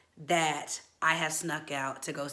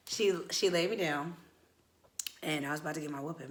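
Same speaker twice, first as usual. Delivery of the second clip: normal talking voice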